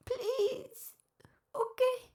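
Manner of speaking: tearful voice breaking up high; crying. Real, not acted. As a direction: choke the words out through real tears. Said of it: "Sorry I am crying so much".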